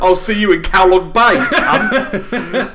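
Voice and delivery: loud laughing farewell. Real, not acted. Mock farewell threat, laughing.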